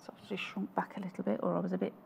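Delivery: very soft, half-whispered mutter to oneself. Real, not acted. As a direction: murmur it under your breath while working. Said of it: "What are you muttering about now?".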